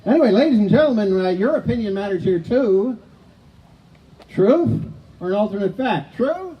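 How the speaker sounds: high, loud address to the crowd; game show host. Real, not acted. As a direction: throw the question out to the audience. Host polls the audience.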